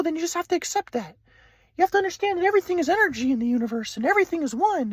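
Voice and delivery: high, airy-calm imitated grandpa; spiritual grandpa. Grandpa from Kauai lecturing Ryan, high serene mock voice. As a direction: preach oneness like a serene guru.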